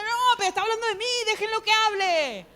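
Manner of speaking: loud, high, insistent plea; acted protest. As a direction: protest loudly, stretching the end. Acts out her protest at the video.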